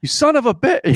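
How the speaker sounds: high, loud, laughing curse; mock outraged yell. Wife yelling from the other room, laughing outrage.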